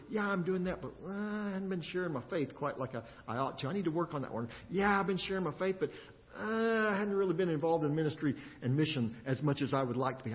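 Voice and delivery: soft, reflective self-talk; musing quietly to yourself; quiet inner musing. Pretend. Imagined listener's inner thoughts.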